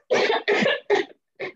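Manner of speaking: real laughter breaking through; laughing. Real, not acted. Host laughing as she thanks Miles.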